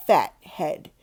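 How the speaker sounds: usual speaking voice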